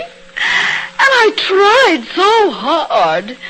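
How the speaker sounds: high, slow crying protest; tearful wail. Acted. Wife crying to her husband over her first party, slow tearful wail. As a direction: wail it through tears over the ruined party.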